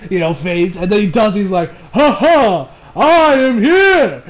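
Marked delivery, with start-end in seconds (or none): 1.9-4.2 big, booming heroic impression, higher and louder; All Might. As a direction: proclaim it slowly with a mock-heroic laugh. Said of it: "he does this All Might impression".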